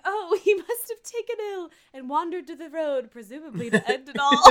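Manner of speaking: a hammed-up, high-pitched lament; mock dramatic. Co-host retelling Moira's line about him taken ill, mock dramatic.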